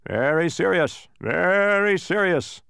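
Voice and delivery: ponderous gateman's tutting tone; grave disapproval. A narrator's reading. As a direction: stretch the repeat with weighty disapproval. Gateman gravely disapproves, shaking head.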